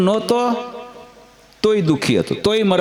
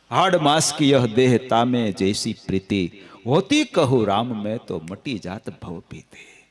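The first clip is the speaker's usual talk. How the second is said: slow, measured chant of the couplet; slow recited verse; 4 semitones lower, slower than usual. Acted. Preacher quoting the wife's rebuke, slow recited verse. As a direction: recite it like a sacred doha.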